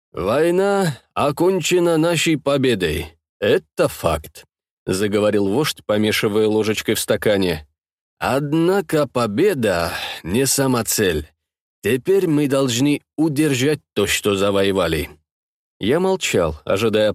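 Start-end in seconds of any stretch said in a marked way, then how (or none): 0.1-4.4 slow, weighty leader voice; measured authoritative statesman; slow commanding leader. Acted. Leader speaks slowly. 8.2-15.1 slow, weighty leader voice; measured authoritative statesman; slow commanding leader. Acted. Leader continues slowly.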